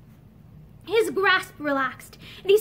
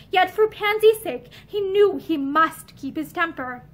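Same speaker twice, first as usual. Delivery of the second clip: ordinary delivery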